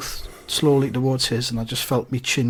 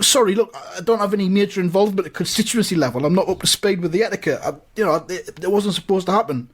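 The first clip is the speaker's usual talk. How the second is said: raised, hurried excuses; fast, higher-pitched backpedalling; panicked apology; 5 semitones higher, faster than usual. Acted. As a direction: gabble an apology in a fluster. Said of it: "I'm panicking".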